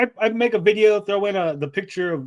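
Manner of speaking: usual speaking voice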